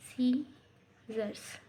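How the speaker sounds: "सीजर्स" drawn out slowly, final s clearly sounded; careful pronunciation. Shown as a demonstration. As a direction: stretch "सीजर्स" so the plural s is heard. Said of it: "इसको सीजर नहीं पढ़ते हैं".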